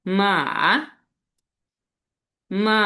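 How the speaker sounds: long "মা" dipping down, then climbing back up; third tone. Shown as a demonstration. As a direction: let "মা" sink, then rise. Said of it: "নিচে নামতেছে". ("মা"-এর সুর নিচে নেমে আবার উপরে)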